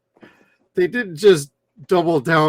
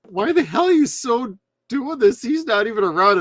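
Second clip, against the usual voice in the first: retelling own reaction; 8 semitones higher than usual. Retold or imitated. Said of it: "I was just asking".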